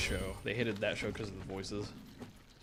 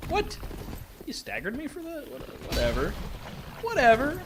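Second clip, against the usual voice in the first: high squeaky outrage; indignant disbelief; 14 semitones higher, 4 dB louder than usual. Real, not acted. Gamer outraged at getting staggered, high indignant squeal.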